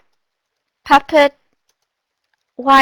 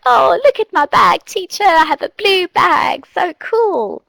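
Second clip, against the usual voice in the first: higher, eager little student voice; excited child; 7 semitones higher than usual. Retold or imitated. A student showing the teacher a new bag, high and eager.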